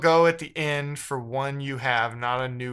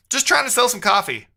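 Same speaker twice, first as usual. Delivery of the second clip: exasperated outburst; 9 semitones higher, 7 dB louder than usual. Real, not acted. Exasperated outburst at broken code.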